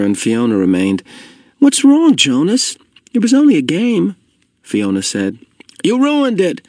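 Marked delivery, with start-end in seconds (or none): 1.6-4.1 put-on high, girlish questioning voice; puzzled protest. A narrator's reading. Girl to upset friend after the war game, puzzled protest. 5.8-6.6 high-pitched annoyed complaint; irritated. A narrator's reading. Boy to friend who spoiled the game, irritated. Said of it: "said in an irritated voice".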